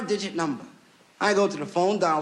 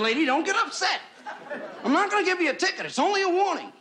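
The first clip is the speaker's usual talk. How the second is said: high-voiced officer; 12 semitones higher than usual. Officer voiced in retold story.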